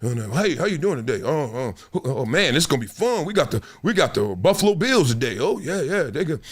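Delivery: soft, low dusty referee chatter; imitating referees. Speaker mimicking referees dressing before a game, soft and low. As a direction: mumble like a nervous old ref. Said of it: "And they".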